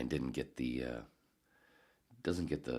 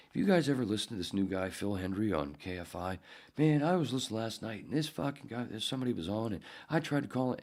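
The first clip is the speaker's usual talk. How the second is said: high-pitched, excited retelling; Mark Hamill; 8 semitones higher than usual. Retold or imitated. Actor telling studio guys about a radio show, excited high voice. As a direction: gush to colleagues about last night's show. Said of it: "And he walked in going".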